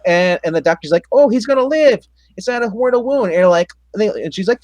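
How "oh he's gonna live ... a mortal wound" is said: high, squeaky cheerful diagnosis; mock doctor. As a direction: ham up a silly relieved doctor, pitched up. Doctor voiced in retold scene.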